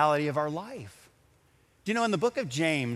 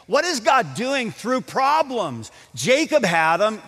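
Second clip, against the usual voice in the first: raised, louder, ringing scripture declaration; preaching at full force; 8 semitones higher, 6 dB louder than usual. Acted. Pastor proclaiming the verse of glory to the congregation, fervent preaching. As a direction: proclaim the verse to the whole hall.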